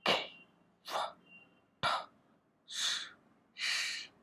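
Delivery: breathy unvoiced खे, फ, ठ, श, slowly; voiceless sound demo. Shown as a demonstration. Teacher recapping the voiceless sounds, slow unvoiced hisses. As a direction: hiss each sound out without voice. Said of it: "सीखा हमने voiceless में".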